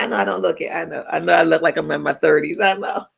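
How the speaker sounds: usual speaking voice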